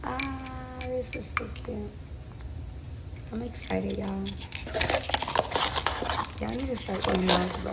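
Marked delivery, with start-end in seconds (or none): none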